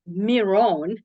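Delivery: "Miron" said Spanish-style, MEE-rone; correct name pronunciation. Shown as a demonstration. Host saying the guest's name properly, "Miron" as mee-RONE. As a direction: say "Miron" with an ee and long, stressed second syllable. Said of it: "I also mispronounce your name".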